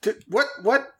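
nervous denial. Acted. Twitchy denies the drugs, jittery and laughing.

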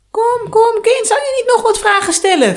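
high, loud wheedling witch; coaxing. Acted. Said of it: "vroeg de Yaga vleiend". (vleiend, hoog en luid)

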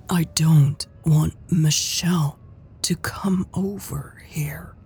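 "I don't want Michelle to come over here" drawled, each word weighted; "I don't want Michelle to come over here" slow and pointed, word by word; emphatic drawl. A narrator's reading. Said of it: "she drawled, putting emphasis on every single word".

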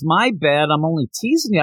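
ordinary talk

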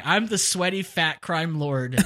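normal talking voice

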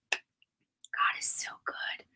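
breathy whispered praise, slow. Real, not acted. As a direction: whisper it reverently to yourself, slowly. Whispered aside to God.

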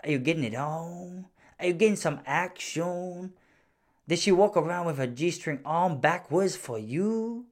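slow, soft, prying judge voice; pry into the couple's sex life, drawn out; lightly voiced prying judge; nosy judge. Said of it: "She go".

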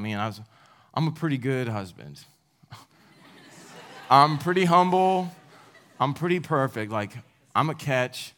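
hammed-up smug self-praise with laughter; mock boastful. Preacher mocking his younger self, smug and laughing. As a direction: puff himself up as the perfect husband, laughing.

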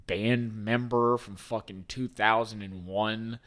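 ordinary talk, nothing marked

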